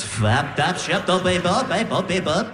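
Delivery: bouncing nonsense syllables; backwards-record gibberish. Retold or imitated. Wife speaking in tongues asleep, backwards-record babble. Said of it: "Sounds like you're turning a record backwards".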